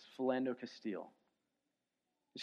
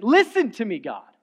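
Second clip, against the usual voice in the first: loud pleading cry, louder and higher; pleading outcry to God; 5 semitones higher, 13 dB louder than usual. Retold or imitated. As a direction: beg God to listen at full voice. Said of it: "a quiet whisper crescendo into that last statement".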